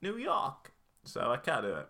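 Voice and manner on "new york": high squeaky bird-like New York "York"; a failed New York accent gone squeaky. Retold or imitated. Co-host laughs at host's squeaky New York accent. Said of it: "You sound like a squeaky bird".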